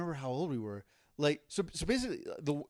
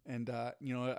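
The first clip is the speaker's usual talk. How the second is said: ordinary delivery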